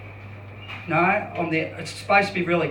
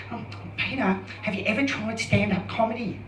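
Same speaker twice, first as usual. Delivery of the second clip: hypnotherapist; 3 semitones higher, 8 dB quieter than usual. Retold or imitated. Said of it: "I don't know how to do a South African accent".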